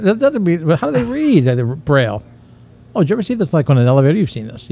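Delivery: ordinary talk, nothing marked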